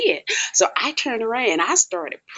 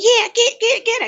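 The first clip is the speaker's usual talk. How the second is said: egging on; 3 dB louder than usual. Retold or imitated. Inmates egging on a fight.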